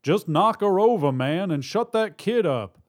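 loud, high, impatient order; yelled. A narrator's reading. Robber to partner at the bank, impatient yell. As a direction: yell across the room at your partner. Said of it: "China yelled".

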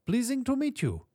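put-on very high, formal greeting in a German or Polish accent; tiny high accented voice; high-pitched. A narrator's reading. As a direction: squeak out a stiffly polite hello. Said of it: "His voice was high-pitched".

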